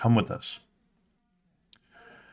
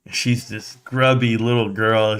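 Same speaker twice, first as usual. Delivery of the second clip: usual speaking voice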